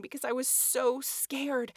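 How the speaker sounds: ordinary delivery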